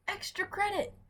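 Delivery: high sneering sing-song; mock the keeners' extra effort. Mocking the other group's effort.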